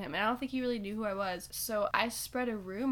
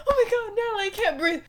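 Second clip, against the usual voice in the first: hammed-up high, louder panic; mock dramatic gasping; 10 semitones higher, 5 dB louder than usual. Prankster pretending to die for her sister, hammed-up. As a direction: overact a fake dying moment. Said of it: "'cause I'm so dramatic".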